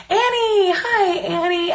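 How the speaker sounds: high, slow happy hello; excited greeting. Real, not acted. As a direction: greet her brightly. Greets helper in chat.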